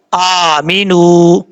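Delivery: آمینو with the long vowel held for one alif; elongated recitation. Shown as a demonstration. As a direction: stretch آمینو slowly. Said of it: "ایک الف کی مقدار لمبا کر کے پڑھیں گے". (آمینو کو ایک الف کی مقدار کھینچ کر)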